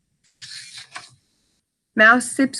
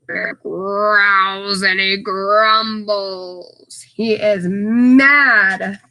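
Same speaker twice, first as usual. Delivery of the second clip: slow growly reading; 3 semitones lower, much slower than usual. Acted. Reading bear's anger.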